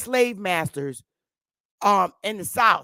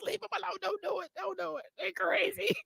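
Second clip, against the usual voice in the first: higher, much softer pleading warning; mock hushed warning; 12 semitones higher, 12 dB quieter than usual. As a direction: plead softly, half joking, don't do it.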